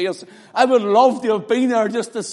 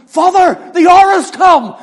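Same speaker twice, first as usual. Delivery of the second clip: shouted cry; 12 semitones higher, 14 dB louder than usual. Acted. Climax of sermon, Jesus crying.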